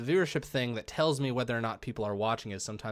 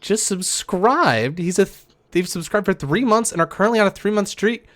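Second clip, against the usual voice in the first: excited shout-out; 8 semitones higher, 11 dB louder than usual. Real, not acted. Subscriber shout-out on stream.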